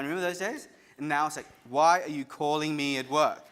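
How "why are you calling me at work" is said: loud, irritated snap at a partner; annoyed partner. Retold or imitated. Partner years on, irritated at being called at work, loud. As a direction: bark it like a fed-up spouse.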